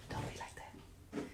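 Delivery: slow whispered aside; whispering. Real, not acted. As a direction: whisper it slowly.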